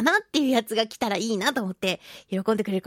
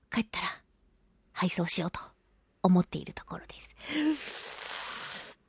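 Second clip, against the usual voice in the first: trailing whisper; 3 semitones lower, 8 dB quieter than usual. Real, not acted. Trails off describing shipping plan.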